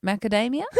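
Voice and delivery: "Macadamia" said the standard way, questioning; switched pronunciation. Retold or imitated. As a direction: offer "Macadamia" innocently with a long "DAY". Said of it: "hands me the bowl and goes".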